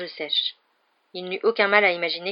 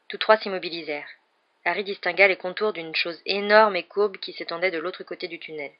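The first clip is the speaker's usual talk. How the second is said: usual speaking voice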